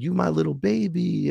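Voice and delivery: high, cooing pet voice; doting high-pitched cooing; baby talk to cat. Retold or imitated. Baby-talking to his cat.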